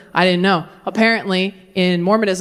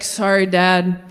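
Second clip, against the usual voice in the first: sheepish son; 5 semitones lower, slower than usual. Retold or imitated.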